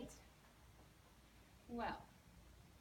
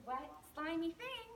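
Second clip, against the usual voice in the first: ordinary delivery, nothing marked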